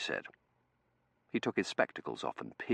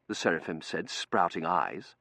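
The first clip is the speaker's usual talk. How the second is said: usual speaking voice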